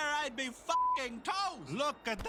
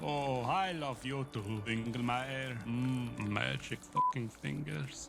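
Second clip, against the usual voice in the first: lower, softened affectionate voice; tender reply; 9 semitones lower than usual. Acted. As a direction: melt and forgive him gently. Vampire reconciles with familiar.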